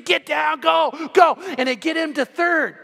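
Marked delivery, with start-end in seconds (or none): 0.0-1.4 loud, high urging yell; shortstop's scream. Retold or imitated. Shortstop screaming Shay on, loud yell. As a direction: yell him home like a teammate. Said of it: "the shortstop of the other team screaming".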